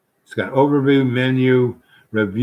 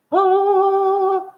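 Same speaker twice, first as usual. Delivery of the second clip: long, very high "ah"; high, sustained sigh of relish; drawn-out squeal of pleasure; delighted sigh; 3 dB louder than usual. Real, not acted. Relishing the remembered food.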